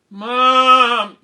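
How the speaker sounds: high, loud, drawn-out whine; whiny protest. Retold or imitated. Child told to get up for school, whiny protest. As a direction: whine back at Mom, long and high.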